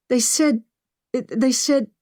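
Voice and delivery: high-pitched, halting repetition; distressed, stuck on the words; faltering, upset. A narrator's reading. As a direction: start and break off, unable to finish. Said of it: "unable to finish the statement".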